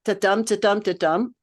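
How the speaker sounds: "ta-dum" with the weight on "dum"; unstressed then stressed beat; iambic beat. Shown as a demonstration. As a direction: tap out "ta-dum, ta-dum, ta-dum" as iambs. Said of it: "So if I were to read it".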